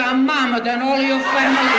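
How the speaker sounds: put-on loud indignant retort in an Italian accent; a furious Italian insult, full volume; shouted comeback. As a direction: yell it back at the fresh clerk. Retort shouted at rude clerk.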